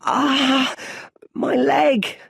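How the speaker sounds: high, loud yelp of pain; a hurt child crying out. Acted. Young Bruce hitting the well floor, loud high cry of pain. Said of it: "he cries".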